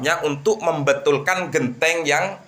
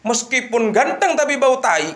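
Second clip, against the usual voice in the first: laughing retort; 9 semitones higher than usual. Acted. Woman's retort, speaker laughing.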